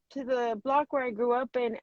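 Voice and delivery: usual speaking voice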